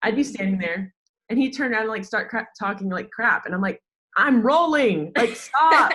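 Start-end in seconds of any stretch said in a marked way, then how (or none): 4.2-5.9 loud, high laughing plea to stop; half-laughing, raised voice, mock scolding; laughing protest. Filming a cussing player, she laughs out stop.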